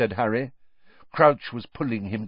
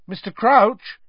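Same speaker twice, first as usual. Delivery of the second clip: higher, louder call; loud urgent call; loudly; 12 semitones higher, 8 dB louder than usual. A narrator's reading. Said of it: "Harry said loudly".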